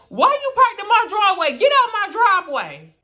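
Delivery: high, loud scolding of a friend's mama; mock yelling. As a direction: holler it like an angry mother. Mama confronts her in driveway.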